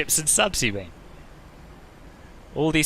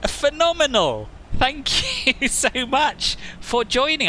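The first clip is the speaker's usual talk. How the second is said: excited greeting; 12 semitones higher, much slower than usual. Real, not acted. Excited greeting of chat members.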